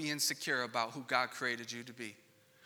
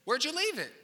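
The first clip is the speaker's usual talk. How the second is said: exasperated parent; 11 semitones higher, 5 dB louder than usual. Retold or imitated. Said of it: "I'm like".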